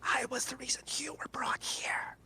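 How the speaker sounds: whispered, high demonic entity. Acted. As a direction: breathe it like an ancient demon. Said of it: "I'm gonna have to do a demonic voice now".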